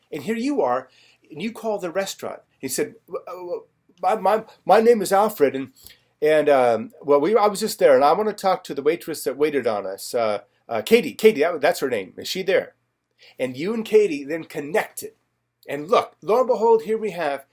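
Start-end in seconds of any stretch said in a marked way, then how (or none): 3.1-12.7 mock nervous stammer. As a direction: imitate a flustered caller, louder in bursts. Acts friend's awkward call to waitress.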